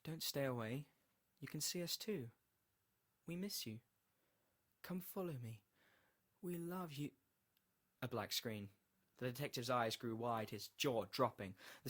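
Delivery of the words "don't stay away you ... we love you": lightly voiced, higher and soft pleading; soft ghostly beckoning; beckoning loved ones. A narrator's reading. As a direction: call him gently in a higher, softer voice. Lost wife and child calling him.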